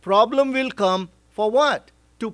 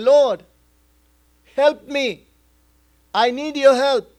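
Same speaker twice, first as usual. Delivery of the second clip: crying out to God; 5 semitones higher, 4 dB louder than usual. Retold or imitated. Woman crying out to heaven.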